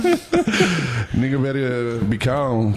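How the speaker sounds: very slow, drawn-out delivery; slow drawn-out catchphrase. Retold or imitated. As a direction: stretch every word slowly. Slowed catchphrase impression.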